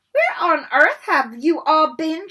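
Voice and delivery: high, loud, slow indignant lady; shrill portrait lady. A narrator's reading. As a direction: demand it like a scandalised lady. Fat lady scolding.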